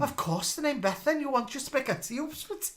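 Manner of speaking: high, mock mother voice; Keeping Faith impression. Asking about spaghetti for tea, high parody voice. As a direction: put on a high TV-drama parody voice. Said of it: "that's our Keeping Faith impressions".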